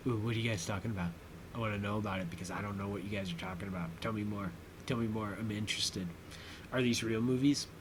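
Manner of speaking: soft, low, eager murmur; hushed curiosity. Acted. Viewer butting into the characters' movie talk, hushed and curious.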